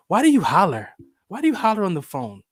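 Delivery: retold frustrated question, pushed up; exasperated question. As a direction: act out a son pleading with mom. Son confronts mom about hollering.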